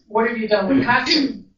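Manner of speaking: retold demand, voice pushed up and out.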